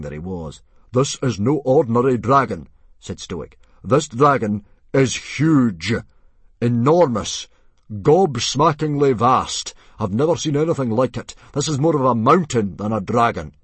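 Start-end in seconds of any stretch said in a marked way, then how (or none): 0.9-2.7 loud, raised, commanding announcement; forceful, urgent proclamation; booming chief Stoic. A narrator's reading. 3.8-13.5 loud, raised declaiming, weighty pauses; booming, emphatic chief; awestruck chief Stoic. A narrator's reading. Plays Stoic stressing the size.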